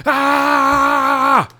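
long high scream; loud, high, sustained mock shriek; top-of-lungs scream. Neighbour screaming from below at night, long high shriek. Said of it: "Screaming at the top of her fucking lungs".

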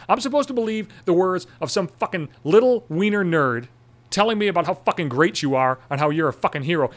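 usual speaking voice

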